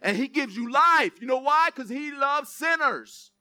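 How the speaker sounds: loud, high preacher's call to the crowd; full-force preaching. Acted. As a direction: proclaim it to the congregation.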